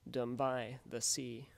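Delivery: slow and loud, beats marked on "by" and "sea"; stressed iambs. Shown as a demonstration. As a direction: spell out the iambs slowly. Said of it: "as in".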